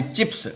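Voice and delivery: चिप्स with a crisp unvoiced स at the end; dental s. Shown as a demonstration. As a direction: end चिप्स on a hissed, voiceless s. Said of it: "दंत स्व होगा".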